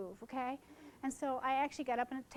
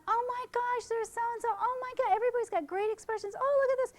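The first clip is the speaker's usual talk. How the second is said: high, loud excited gasping praise; mother's delighted gushing; 10 semitones higher, 6 dB louder than usual. Retold or imitated. Mother seeing the album, much higher and louder excited gushing. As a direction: gush over the photos like a thrilled mother. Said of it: "the mother looked at this and she went".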